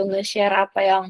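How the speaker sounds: ordinary delivery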